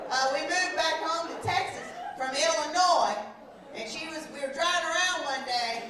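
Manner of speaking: ordinary talk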